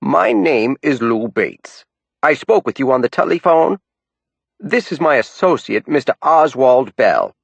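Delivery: put-on older woman in thick Down East accent. A narrator's reading. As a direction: introduce herself in thick Down East. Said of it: "in a thick Down East accent".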